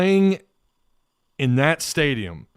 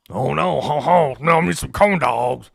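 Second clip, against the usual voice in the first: put-on half-hearted Cajun accent, a little louder on ho, ho; a clumsy try at a Cajun accent, laughing it off; bad Cajun accent; 4 dB louder than usual. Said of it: "I can't do the Cajun accent".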